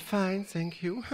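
lightly voiced shy starlet answering the medium; shy Marilyn. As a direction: play a timid Marilyn, a touch coy. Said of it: "je vois qu'elle est un peu timide".

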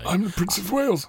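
high, soft royal impression; strained, hushed amateur royal; Prince Charles. Cutting in on co-host's critique, high royal impression. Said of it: "This is my first ever impression".

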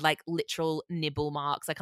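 usual speaking voice

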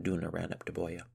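ordinary delivery

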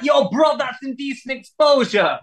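high, mock-outraged bloke; angry stranger. Stranger at the van door accusing him, high mock outrage.

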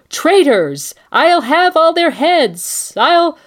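high, loud tyrant's threat; furious outburst. Acted. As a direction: shout it in drawn-out royal outrage. Acts Joffrey's outburst after fleeing riot.

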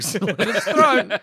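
slow, laughing gleeful answer. Acted. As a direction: chuckle through it with glee.

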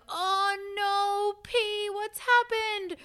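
high, drawn-out mock wail; put-on high cry of alarm over a pet; imitating distressed partner. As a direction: wail it high and slow, in dismay. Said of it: "I wake up to Renan going".

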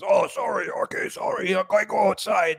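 hammed-up sheepish apology at four in the morning; imitating Russian roommate.

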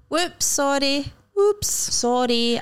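slow playful apology from the car; drawn-out mock apology. Shown as a demonstration. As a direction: stretch it like a cute apology button. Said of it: "Just a wee".

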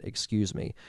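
ordinary delivery

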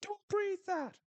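high, frantic mock yelling; hammed-up panicked high shout. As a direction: yell it like stopping a friend mid-snort.

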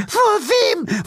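very high, indignant question; the lady, baffled and offended; high old-lady voice. A narrator's reading.

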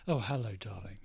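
low, soft smooth charmer; local rogue. Pretend. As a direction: purr it like a yacht-club rogue. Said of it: "like the local rogue".

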